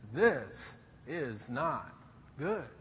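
very slow, low and soft; simply said. Retold or imitated. Father-in-law watches Moses and warns him, quiet and slow. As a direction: let each word fall quietly, with weight. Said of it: "he simply says".

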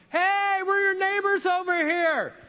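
high, drawn-out holler to a neighbor; yelling out. Retold or imitated. Calling to a neighbor walking away, yelling out. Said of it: "I yell out to him".

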